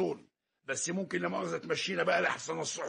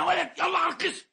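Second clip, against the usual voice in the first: angry shout; 10 semitones higher, 3 dB louder than usual. Acted. Quarrel, heading to police.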